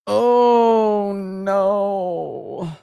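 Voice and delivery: stunned, slow, drawn out; shocked. Real, not acted. Streamer shocked by a viewer's fan art, slow and stunned. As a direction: let the horror sink in slowly.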